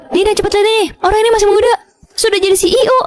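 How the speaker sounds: high, gushing coworker; excited gossip. Acted. Coworker telling Dina about young CEO, excited. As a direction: gush over the handsome CEO.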